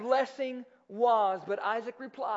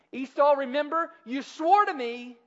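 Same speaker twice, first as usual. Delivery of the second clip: raised, higher-pitched acted challenge; insistent appeal; 5 semitones higher, 8 dB louder than usual. Jacob confronting his brother before their father, insistent appeal. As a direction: call him out loudly on his oath.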